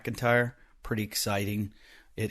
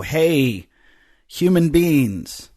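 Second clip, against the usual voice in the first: high, slow, loud overdone greeting; mock gender neutral host; 5 semitones higher, 7 dB louder, much slower than usual. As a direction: greet them like an earnest host, drawn out. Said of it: "we just go".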